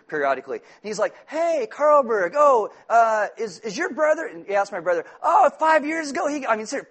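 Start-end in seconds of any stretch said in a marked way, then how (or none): 1.3-4.2 higher-pitched mock voice of an old mayor; Larry. Old mayor asking preacher about his brother, imitated high voice. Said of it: "Larry, the old mayor of Santa Maria". 5.2-6.5 higher-pitched mock voice of an old mayor; Larry. Old mayor asking preacher about his brother, imitated high voice. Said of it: "Larry, the old mayor of Santa Maria".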